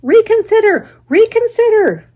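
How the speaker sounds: very high, loud goose yell; the goose yells at departing Wilbur, high and loud. Acted. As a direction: yell it as a last plea. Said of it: "yelled the goose".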